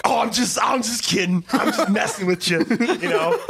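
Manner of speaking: high, laughing mock of a jokester; mocking jokey voice. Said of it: "sometimes he'll go like".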